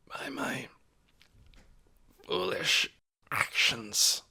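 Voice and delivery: sinking to a faint whisper. A narrator's reading. Sick old Ben whispering his apology to the hushed room. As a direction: let the voice die to a whisper. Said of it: "Ben's voice sank to a whisper".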